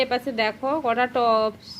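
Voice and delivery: দেখো stretched and slow; stress on দেখো. Shown as a demonstration. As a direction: lean on দেখো, slow and clear. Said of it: "ভালো করে পড়ো মন দিয়ে".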